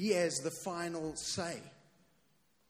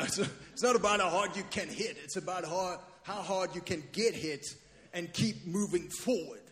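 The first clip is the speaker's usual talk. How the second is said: Rocky; 3 semitones higher than usual. Acted. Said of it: "that's my Rocky impression".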